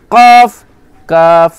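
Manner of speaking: normal talking voice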